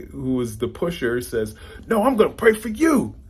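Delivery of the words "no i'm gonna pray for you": very high, loud comeback snapping the line back; mock yelling back. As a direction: top the other guy, pitched even higher. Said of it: "they're yelling back and forth".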